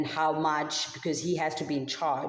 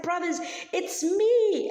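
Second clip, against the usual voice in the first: much higher, louder and slow, bursting with joy; very excited; 13 semitones higher, 3 dB louder than usual. Acted. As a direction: Joseph overjoyed, calling out to his brothers. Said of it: "Joseph gets so excited".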